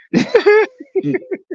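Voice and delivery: shrieking cackle; high cackle. Real, not acted. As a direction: cackle hard. Laughing at own joke.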